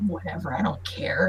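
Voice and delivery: soft, whispered, sulky dismissal; jealous whisper. Acted. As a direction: whisper it like a jealous assistant who won't care. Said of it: "she's kinda jealous".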